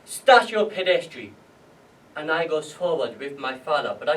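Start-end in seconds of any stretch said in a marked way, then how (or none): 0.1-1.3 loud roll-call voice; officer loudly reading off a name. Retold or imitated. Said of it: "He calls out".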